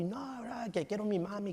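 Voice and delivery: imitated tearful little girl; whining toddler. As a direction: whine for mommy like a two-year-old. Imitates crying toddler wanting mom.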